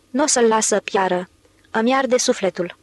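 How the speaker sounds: plain reading voice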